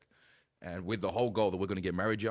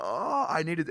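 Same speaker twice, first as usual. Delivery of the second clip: overwhelmed reaction; 9 semitones higher, 8 dB louder, slower than usual. Retold or imitated. Acts out his reaction to sermon.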